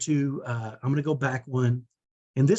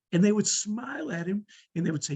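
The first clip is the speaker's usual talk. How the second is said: ordinary talk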